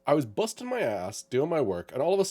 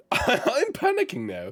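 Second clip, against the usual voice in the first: high, slow mock-panicked voice with a laugh; retold panic; slower than usual. Telling friends about mystery charges, in laughing mock panic.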